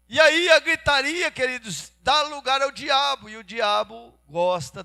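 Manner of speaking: loud, high sermon voice easing down; impassioned preaching. Real, not acted. Preacher warning the congregation about shouting matches, fervent. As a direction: warn them forcefully, then start to settle.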